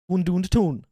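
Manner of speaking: practising a Geordie catchphrase; Geordie accent. Shown as a demonstration. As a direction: have a go at Geordie. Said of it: "a phrase to get into it".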